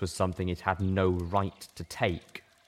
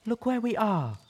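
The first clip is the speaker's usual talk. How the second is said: reasonable; 14 semitones higher than usual. Acted. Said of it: "Velvet tried to be reasonable".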